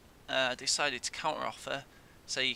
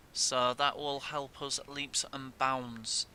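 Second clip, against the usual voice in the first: slow emphatic; slower than usual. Real, not acted.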